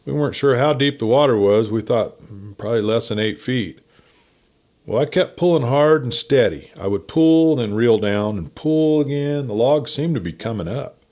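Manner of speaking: usual speaking voice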